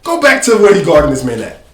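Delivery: ordinary talk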